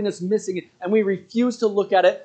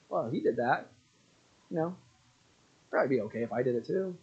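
low, quiet, faster musing voice of a man excusing himself; muttering tempted man; 8 semitones lower, 12 dB quieter than usual. Retold or imitated. A man in the camp quietly justifying his own sin. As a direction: talk it low and sly, like talking yourself into it. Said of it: "and some guy's like".

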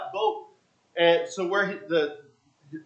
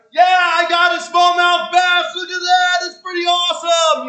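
proud excited shout; 12 semitones higher, 10 dB louder than usual. Retold or imitated. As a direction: yell out thrilled with the fish. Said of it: "he's like".

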